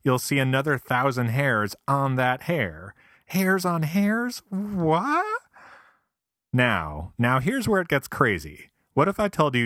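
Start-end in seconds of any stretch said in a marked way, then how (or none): 3.3-5.4 astonished disbelief. Pretend. Mock disbelief at microscope.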